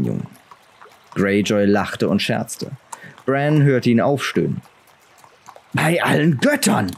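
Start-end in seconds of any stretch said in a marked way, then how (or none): 5.7-6.9 called out. A narrator's reading. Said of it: "rief er".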